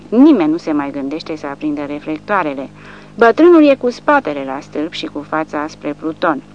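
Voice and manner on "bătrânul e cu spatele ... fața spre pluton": ordinary delivery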